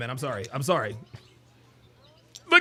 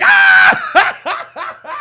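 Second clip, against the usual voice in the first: much higher, louder shriek of a cry; high laughing yelp; 12 dB louder than usual. Retold or imitated. Voicing the man in the clip.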